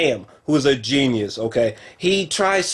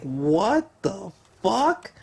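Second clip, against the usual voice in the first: stunned what the fuck; 4 semitones higher, 4 dB quieter, much slower than usual. Retold or imitated. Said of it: "He's just like".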